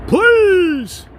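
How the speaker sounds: high, loud exasperated "please"; loud fed-up plea; exasperated cry. Real, not acted. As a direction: throw your hands up and yell it. Exasperated outburst.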